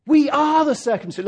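"are" given the weight in "We are the circumcision"; stress on ARE. Shown as a demonstration. Preacher showing the congregation stress on "are". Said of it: "all the emphasis ... on the word "are".

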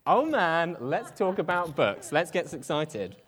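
gushing about books; inner excitement. Retold or imitated. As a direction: let the book lover burst out. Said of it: "On the inside, I'm like".

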